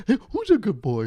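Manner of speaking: put-on high, gushing who's a good boy; doggy baby talk. Friend cooing over the host's dog in high baby talk. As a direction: coo it at the dog, sugary.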